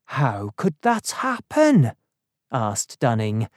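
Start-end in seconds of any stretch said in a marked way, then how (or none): 0.1-1.9 higher-pitched, anxious question; worried. A narrator's reading. Dunning questions the doctor about his servants, worried. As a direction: ask in worried disbelief. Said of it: "feeling more worried than ever".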